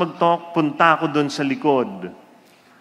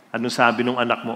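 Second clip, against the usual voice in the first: usual speaking voice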